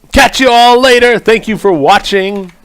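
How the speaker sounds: loud, high sign-off call; bright-pitched, projected farewell. Acted. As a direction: shout goodbye to the audience. Calling out the sign-off.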